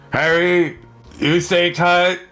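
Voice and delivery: reassuring. Acted. Character reassures trapped friend.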